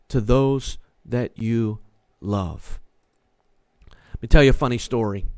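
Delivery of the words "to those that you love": tender. Acted. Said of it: "tender close of point".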